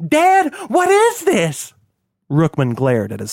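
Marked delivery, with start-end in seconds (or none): 0.1-1.7 very high, slow wail to father; panicked shriek. A narrator's reading. Struggling son pleading to his father, panicked shriek. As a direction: shriek for Dad in terror. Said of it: "he shrieked".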